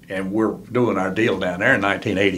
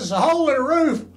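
mock alarmed yelp, much higher; imitating Cale's surprise; 14 semitones higher than usual. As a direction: squeal it out like startled Cale. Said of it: "Cale says".

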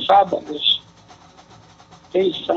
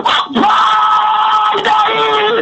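shouting; 7 semitones higher than usual. Real, not acted. Caller shouting a carnival greeting.

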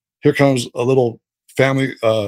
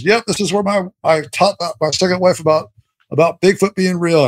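imitating Charlie Tom; 6 semitones higher than usual. Imitating Charlie Tom telling story.

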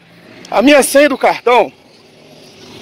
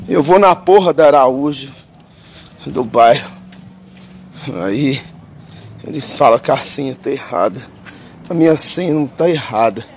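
low, drained grumbling; dejected complaint; 8 semitones lower, 3 dB quieter than usual. Real, not acted. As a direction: recount it deep down in pitch, worn out and defeated. Defeated recap of the card mishap.

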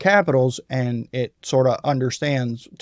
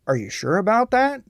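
mildly confused; 7 semitones higher than usual. Shown as a demonstration.

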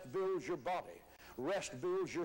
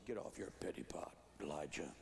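low, hushed deadpan rebuke; God; 8 dB quieter than usual. Acted. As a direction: drop way down, near whisper, to puncture the whining. God's dry reply to Elijah's wailing.

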